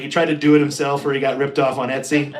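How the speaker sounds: ordinary delivery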